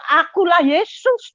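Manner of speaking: a lightly voiced, majestic Jesus. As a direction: announce who you are, slowly and high. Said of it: "Dia bilang".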